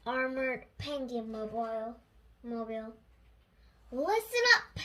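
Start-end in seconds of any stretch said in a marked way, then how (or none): 3.9-4.8 loud, high rallying call; commanding cry. Acted. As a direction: bark it at the troops.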